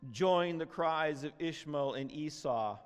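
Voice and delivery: ordinary delivery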